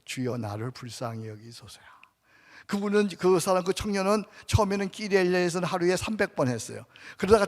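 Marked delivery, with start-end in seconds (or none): 0.0-1.8 quiet prayer demo. Shown as a demonstration. Preacher demonstrates the prayer.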